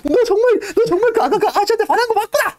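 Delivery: very high, loud teasing voice; mocking tease. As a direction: rib him about the crush. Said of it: "막, 막 놀려대요".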